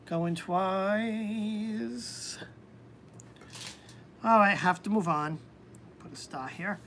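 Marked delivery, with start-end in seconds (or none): 0.1-2.4 softer auctioneer call, TWICE held long; drawn-out countdown. Pretend. Host waiting on absent viewer, drawn-out second call. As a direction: stretch out TWICE in a long, sustained tone.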